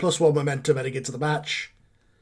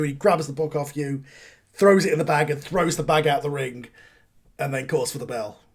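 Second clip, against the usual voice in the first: ordinary delivery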